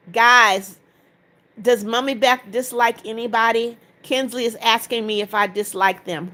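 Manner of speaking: usual speaking voice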